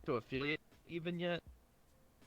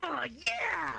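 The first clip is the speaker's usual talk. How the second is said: bright high excited yeah; high girly cheer; 12 semitones higher than usual. Shown as a demonstration. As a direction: squeal a happy yes. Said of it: "switch my voice".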